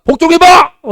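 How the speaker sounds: 높고 큰 반농담 고함; 복종하라고 크게 외치듯. Retold or imitated. 학생을 다그치는 흉내의 절정. (학생에게 외치는 높고 큰 장난스러운 고함)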